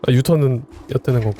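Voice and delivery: normal talking voice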